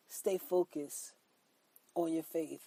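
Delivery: ordinary delivery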